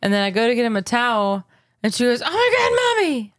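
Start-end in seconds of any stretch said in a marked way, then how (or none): 2.2-3.3 put-on high alarmed little girl; child voice. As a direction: shriek it like a kid. Child alarmed in bath story.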